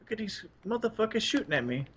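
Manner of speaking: usual speaking voice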